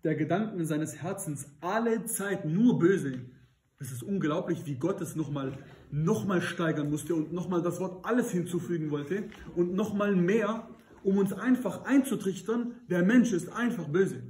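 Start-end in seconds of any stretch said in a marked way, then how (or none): 0.0-3.2 langsam und getragen vorgelesen; feierliche Bibellesung. Acted. Liest Bibelvers feierlich vor. 5.9-14.2 steigert sich hoch und lauter; predigend gesteigert. Acted.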